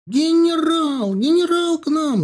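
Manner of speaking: high, loud woman's yell, drawn out. A narrator's reading. Servant woman announcing the general to the household, yelling. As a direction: holler the alarm into the house. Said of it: "заорала, юркнув в дверь".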